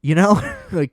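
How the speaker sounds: ordinary talk, nothing marked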